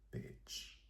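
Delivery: whispered bitch; whispered insult. Retold or imitated. Adding a cheeky tag to Angel's line, whispered. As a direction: breathe bitch under your breath. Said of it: "I added that last part".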